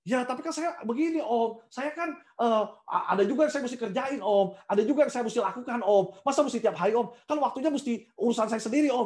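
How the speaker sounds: a hint of a reluctant youth; mock excuses. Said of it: "ini contoh ya". (jemaat beralasan kepada Om Ferdi, merengek)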